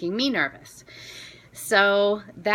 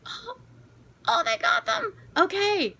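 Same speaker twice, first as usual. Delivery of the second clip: very high, startled squeak of relief; stunned squeak. Retold or imitated. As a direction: squeak it out, caught off guard. Her own reaction, retold.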